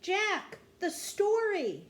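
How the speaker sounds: high, loud call for help; urgent cry. A narrator's reading. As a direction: cry out to remind him urgently. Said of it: "cried Annie".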